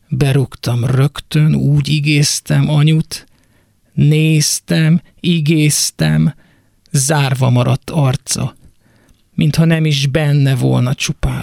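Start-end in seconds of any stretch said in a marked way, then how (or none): none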